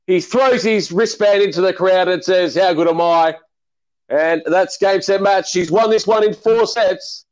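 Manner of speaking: mock TV tennis commentary; play-by-play commentary. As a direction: call the point like a TV commentator. Post-match commentary.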